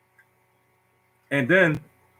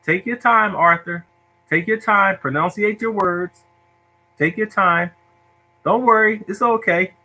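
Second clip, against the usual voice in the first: higher, measured reassuring teacher voice; nice teacher; 5 semitones higher, slower than usual. Retold or imitated. Teacher calming a stuttering boy, patient and reassuring. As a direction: coax a struggling child patiently in a raised pitch. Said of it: "Teacher's like".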